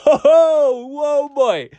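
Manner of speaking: high, laughing burst of surprise; surprised laugh. Real, not acted. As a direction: caught off guard and amused. Reacting to Jamaican-style track.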